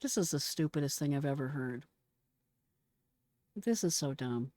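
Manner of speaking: usual speaking voice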